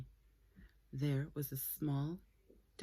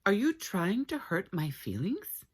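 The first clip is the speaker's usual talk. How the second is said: higher, louder wounded little dog; hurt small dog asking; 6 semitones higher, 6 dB louder than usual. Acted. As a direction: demand it, stung and small.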